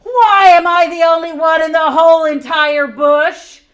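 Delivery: loud, high hammed-up wail; wailing koala. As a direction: howl it like a wronged koala.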